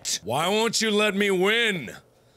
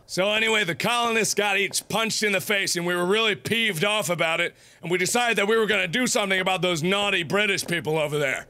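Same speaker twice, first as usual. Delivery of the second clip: usual speaking voice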